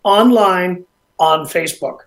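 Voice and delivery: loud, upbeat radio host read; radio host announcer. Acted. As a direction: pump up the show return. Radio host back from break.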